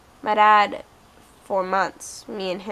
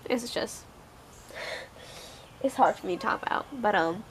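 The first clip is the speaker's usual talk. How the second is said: crying; 6 dB quieter than usual. Real, not acted. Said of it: "I'm sorry I'm crying".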